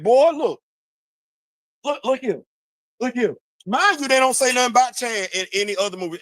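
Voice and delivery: ordinary delivery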